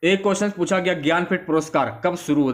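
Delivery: usual speaking voice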